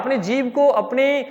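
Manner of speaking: usual speaking voice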